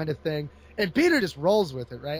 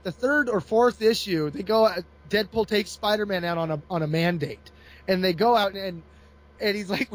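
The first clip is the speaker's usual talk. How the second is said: ordinary talk, nothing marked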